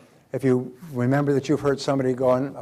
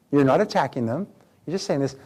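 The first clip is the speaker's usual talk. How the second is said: ordinary talk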